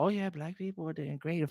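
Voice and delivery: high, soft mock chirpy voice; mock complacent rich. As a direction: sneer at rich folks' cheerfulness. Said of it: "sit there and talk about".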